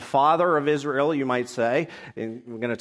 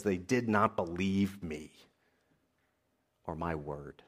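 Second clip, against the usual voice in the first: hushed lament; 8 semitones lower, 10 dB quieter than usual. Acted. Quoting God's lament in scripture.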